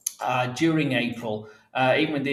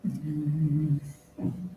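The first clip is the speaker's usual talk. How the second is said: off mic; slower than usual. Real, not acted. Still searching files, off mic.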